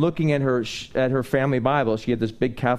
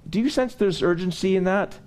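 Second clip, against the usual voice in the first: full-force preaching; 8 semitones higher than usual. Acted. Preacher urging the congregation not to linger, fervent. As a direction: drive the sermon home to the congregation.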